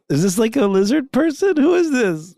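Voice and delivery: can't believe what he's hearing; high, baffled "who is this?"; incredulous surprise. Real, not acted. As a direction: voice jumps high in disbelief. Host reacting to bizarre email.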